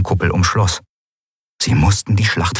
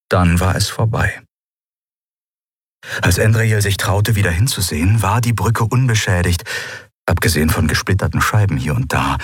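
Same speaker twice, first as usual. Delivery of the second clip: ordinary delivery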